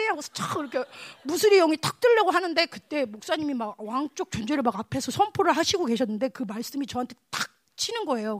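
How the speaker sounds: ordinary talk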